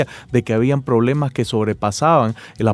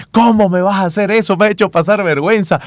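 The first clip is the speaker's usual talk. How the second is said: mother's reproach, higher and louder; a mom chiding her son, raised voice; scolding mother; 6 semitones higher, 3 dB louder than usual. Retold or imitated. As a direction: scold your little boy, embarrassed and upset. Mother scolding child in story.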